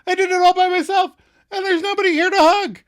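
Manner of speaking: high, loud triumphant outburst; very high-pitched excited yelling; excited shout. Retold or imitated. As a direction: shout it out with hammed-up glee. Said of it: "excitedly starts shouting".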